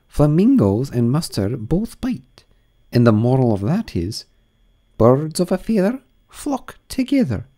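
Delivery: high grand duchess voice; imperious plummy grande dame. Acted. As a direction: purr like a grand old duchess.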